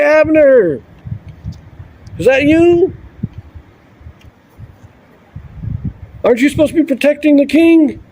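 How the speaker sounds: high-pitched mocking call across the camp; David taunting the soldiers, high and mocking. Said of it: "he starts kind of taunting them".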